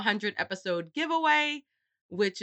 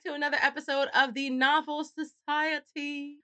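higher-pitched mock British host; put-on British accent; 6 semitones higher than usual. Welcoming listeners to the podcast in a put-on British accent. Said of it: "I can't do that accent".

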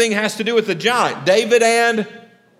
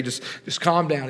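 softer, calming voice; soothing God; 3 semitones lower, 7 dB quieter than usual. Retold or imitated. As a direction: talk Moses down gently. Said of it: "This is the Matthew remix".

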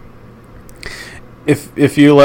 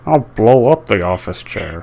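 Bane voice. Retold or imitated. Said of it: "Bane is doing a Dark Knight Rises impression".

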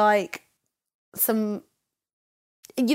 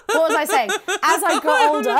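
loud, high laughing through words; cracking up mid-sentence; 5 semitones higher, 6 dB louder than usual. Real, not acted. Laughing fit while restarting.

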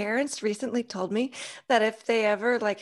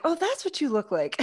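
higher-pitched, light surprise, retold; surprised realization; 9 semitones higher than usual. Teacher seeing students' unmasked faces, surprised realization. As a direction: act out a pleased discovery.

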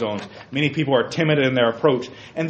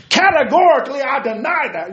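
loud high pompous declaration; mock pundit; 9 semitones higher, 5 dB louder than usual. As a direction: pronounce big words grandly. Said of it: "throws out all these big words".

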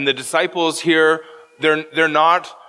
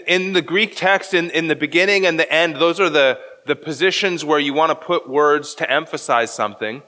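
usual speaking voice

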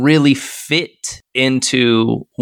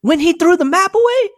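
you can't be serious, high and pressed; incredulous; faster than usual. Real, not acted. Disbelief at partner's opinion.

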